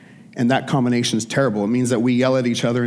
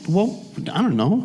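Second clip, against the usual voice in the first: shy; 6 semitones higher than usual. Retold or imitated. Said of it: "kinda with that shy".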